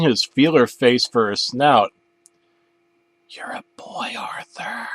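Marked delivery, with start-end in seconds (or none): 3.3-4.9 soft unvoiced whisper. A narrator's reading. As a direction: ghostly whisper. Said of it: "a voice whispered".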